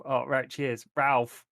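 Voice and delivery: usual speaking voice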